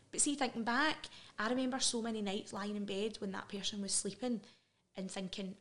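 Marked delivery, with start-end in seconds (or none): none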